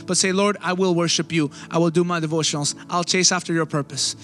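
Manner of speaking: ordinary delivery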